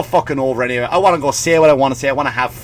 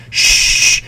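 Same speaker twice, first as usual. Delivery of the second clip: shush. Real, not acted. Shushing the audience.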